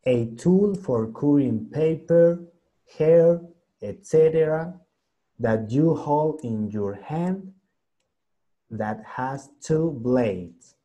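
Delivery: each English word drawn out for learners; slow reading aloud. Shown as a demonstration. Teacher rereads the sentence to her Zoom class, slow and clear. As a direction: read it slowly, word by word. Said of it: "Voy a leerles la siguiente imagen".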